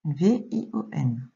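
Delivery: slow, separated spelling; letter by letter. Shown as a demonstration. Teacher correcting a pupil's dictation, letter by letter. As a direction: spell it out with gaps between letters.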